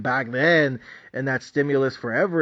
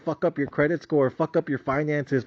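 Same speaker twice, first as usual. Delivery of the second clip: usual speaking voice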